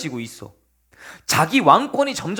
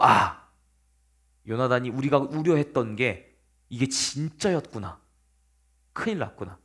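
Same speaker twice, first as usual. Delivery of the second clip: low, hushed inner voice; grim realization; 9 semitones lower, 6 dB quieter than usual. Acted. Fugitive learning their fear was true, low and sobered. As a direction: sink into subdued dismay.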